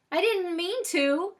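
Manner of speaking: high, slow, loud whine; shaky, near crying. A narrator's reading. Stormy says again he didn't mean it, high and tearful. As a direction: plead as if about to cry. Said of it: "His voice sounds shaky, like he might start crying".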